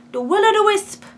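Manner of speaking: high, loud attempted Scottish accent. Shown as a demonstration. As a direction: try the Scottish accent on Will-O-the-Wisp. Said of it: "I can't do a Scottish accent".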